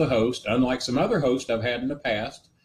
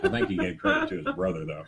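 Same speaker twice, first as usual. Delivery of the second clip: words broken up by a high laugh; faster than usual. Real, not acted. Host laughing at his own Cena joke, laughing. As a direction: laugh through the line about his brother.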